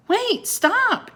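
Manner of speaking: high, loud pleading cry; shout. A narrator's reading. Annie begs the serpent to stop, loud high shout. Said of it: "Annie shouted at the sea serpent".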